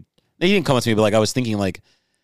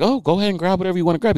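mock friendly clerk; 9 semitones higher, faster than usual. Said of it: "She's like".

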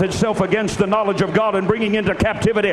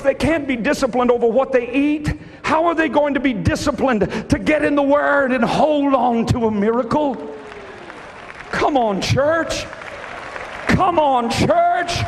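higher, driving shouted exhortation; full-force preaching; 7 semitones higher than usual. Acted. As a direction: rouse the church to its feet. Rising sermon close, full force.

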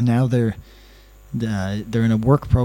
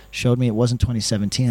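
usual speaking voice

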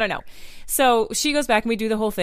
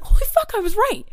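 excited realization; 10 semitones higher, 5 dB louder than usual. Retold or imitated. Realizing she was right.

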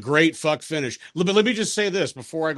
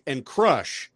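ordinary delivery

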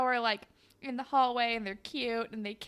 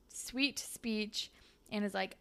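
normal talking voice

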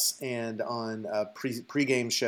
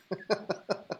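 laugh; 8 semitones higher, 8 dB quieter than usual. Real, not acted. Laughter at joke.

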